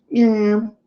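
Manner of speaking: high mewing cat sound; high cat meow. Retold or imitated. Imitating his cat begging for treats, a high meow. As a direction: mew like a small cat.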